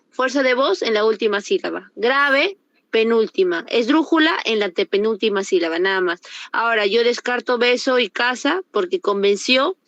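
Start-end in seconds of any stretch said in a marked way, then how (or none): none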